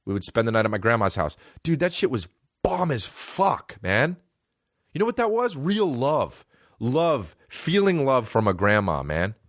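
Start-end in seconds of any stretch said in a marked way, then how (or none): 1.6-9.0 sentimental gush. Real, not acted. Nostalgic about grandma's love.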